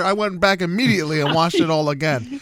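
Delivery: ordinary talk, nothing marked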